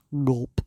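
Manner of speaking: loud startled gulp; comic scared gulp; nervous gulp. Acted. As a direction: gulp in fright. Fearful gulp.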